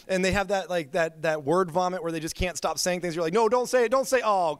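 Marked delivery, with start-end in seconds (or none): 3.3-4.6 pleading higher and louder, half-joking alarm; mock dread. Speaker pleading with a tactless friend, mock panicked. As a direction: beg him not to say it, in mock panic.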